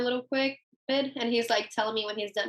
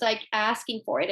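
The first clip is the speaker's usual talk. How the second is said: normal talking voice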